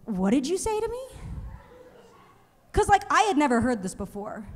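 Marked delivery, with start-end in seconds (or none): 0.0-1.1 higher, startled mock question; taken aback. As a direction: act thrown by the greeting. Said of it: "I go".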